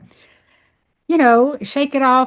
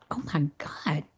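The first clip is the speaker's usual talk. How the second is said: low, far softer gasp of realisation; awed hush; 7 semitones lower, 13 dB quieter than usual. Retold or imitated. Realising what she had been eating, hushed awe. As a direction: let it slip out, barely there.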